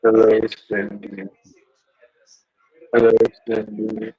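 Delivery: voice-changer killer greeting; Ghostface voice. Pretend. Showing Randy the toy by greeting Cindy, ghost face voice. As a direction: greet her as the masked killer, through a voice changer. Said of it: "has the ghost face voice".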